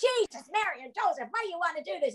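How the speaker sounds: high-pitched American stage outburst; put-on stage American accent. Actress on stage startling her new co-star, American accent. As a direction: exclaim it in character with an American accent. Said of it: "hello to her in this very British accent".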